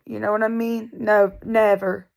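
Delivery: ordinary delivery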